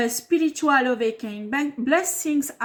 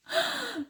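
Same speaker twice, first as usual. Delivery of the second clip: short embarrassed laugh; 14 semitones higher, 6 dB quieter than usual. Real, not acted. Embarrassed laugh mid-apology.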